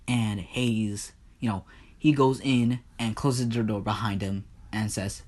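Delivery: usual speaking voice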